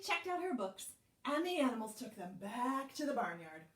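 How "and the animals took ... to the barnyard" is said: usual speaking voice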